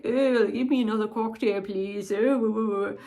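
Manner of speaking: hammed-up snobby, super-posh plummy British accent; David Case. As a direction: do a bad mock-posh British snob. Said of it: "he just sounds super snobby, super posh".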